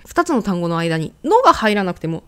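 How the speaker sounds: ordinary talk, nothing marked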